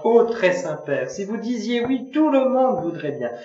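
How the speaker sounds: put-on a girl pleading on her knees. A narrator's reading. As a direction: beg him with a lifted voice. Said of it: "d'une voix suppliante".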